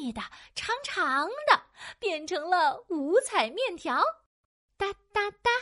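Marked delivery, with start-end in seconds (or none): 4.8-5.5 high, even tapping noise; staccato sound effect. Acted. Storyteller voicing the noodle making, high tapping sound. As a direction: tap out three high, separate syllables. Narrator imitates chopping noodles.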